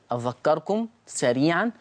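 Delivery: ordinary delivery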